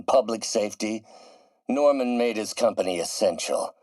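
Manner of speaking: usual speaking voice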